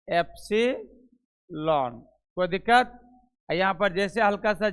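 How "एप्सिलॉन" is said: drawn out slowly, syllable by syllable; teacher spelling it out slow; slow model pronunciation. Shown as a demonstration. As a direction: say epsilon slowly for the class to copy. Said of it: "इसको कहते हैं epsilon".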